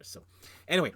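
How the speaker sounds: ordinary delivery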